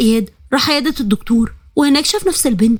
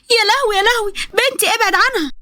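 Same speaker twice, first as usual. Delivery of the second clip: frantic wail; 9 semitones higher than usual. Acted. Yusra sees her daughter beaten.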